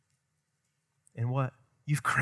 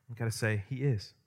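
normal talking voice

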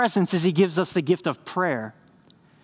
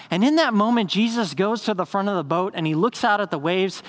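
usual speaking voice

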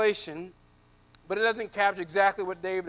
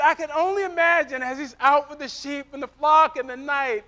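ordinary delivery

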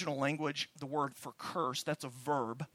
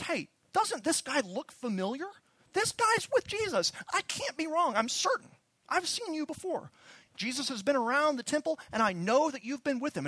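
put-on much higher, louder voice of an accusing girl; 11 semitones higher, 4 dB louder than usual. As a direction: point him out to the whole crowd. Girl accuses Peter to crowd.

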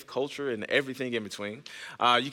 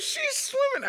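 very high mock-crying child; Kylie voice; slower than usual. Four-year-old complaining to dad about friend, imitated tearful squeal. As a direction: whine it like an upset little girl. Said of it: "starts to whine and cry".

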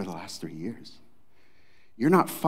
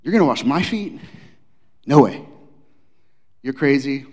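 higher and louder, mock outrage; incredulous Peter; 6 semitones higher, 7 dB louder than usual. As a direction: tell the Lord he's lost his mind. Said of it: "Better translated is like".

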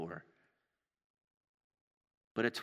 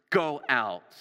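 loud, raised-pitch order; forceful command; 4 semitones higher, 8 dB louder than usual. Acted. Jesus putting the laughing mourners outside, acted forceful command. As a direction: throw them out with your voice. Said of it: "there was some force behind".

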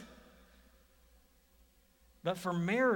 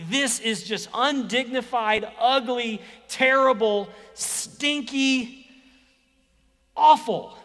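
rising higher and louder word by word; building disgust; 6 semitones higher, 10 dB louder, slower than usual. Retold or imitated. Preacher voicing a mourner's revulsion, building to a shout. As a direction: pile the words up, each one louder.

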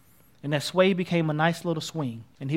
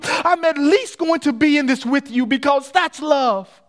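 loud, higher, fervent declaration; impassioned preaching; 8 semitones higher, 6 dB louder than usual. Acted. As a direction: preach a friend's devotion at full force. Preacher voices boy's climactic confession.

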